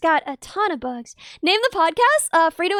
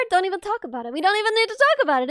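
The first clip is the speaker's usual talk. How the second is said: normal talking voice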